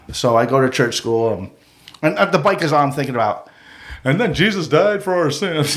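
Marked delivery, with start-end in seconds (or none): 4.0-5.8 droning preacher. Retold or imitated. Mocking the church lesson.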